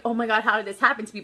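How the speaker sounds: quick, understated dismay; not happily shocked. Shown as a demonstration. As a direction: rush it out like mild, unhappy shock. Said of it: "not like".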